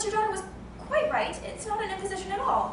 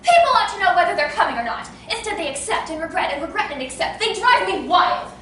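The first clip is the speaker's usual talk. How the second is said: going mad; 3 semitones higher, 8 dB louder than usual. Acted. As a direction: wail about the ruined dinner party. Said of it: "I shall go mad!".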